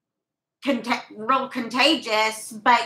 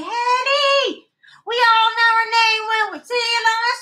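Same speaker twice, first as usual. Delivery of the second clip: excited greeting; 10 semitones higher than usual. Real, not acted. Calling out a viewer's name.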